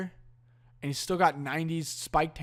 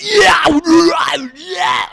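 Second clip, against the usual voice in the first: tough shout; 11 semitones higher, 14 dB louder than usual. Retold or imitated. Mocking the band being hard.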